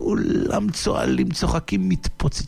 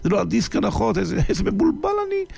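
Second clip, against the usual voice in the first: high, fast, flustered cry of the bumbling magician; panicking as the wrong disc plays; 7 semitones higher than usual. A narrator's reading. Magician realising the wrong CD is on, high and flustered. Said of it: "אמר הקוסם".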